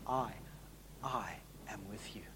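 soft breathy whisper of I, I am with you. Shown as a demonstration. Preacher whispering Jesus's promise to the church. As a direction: drop to a hushed whisper on the I. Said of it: "The I repeated".

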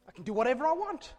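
mock boast. Mocking the sinner's boast.

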